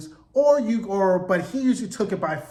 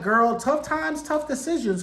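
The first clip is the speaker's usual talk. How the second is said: sassy sisters; 6 semitones higher than usual. Retold or imitated. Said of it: "They're like".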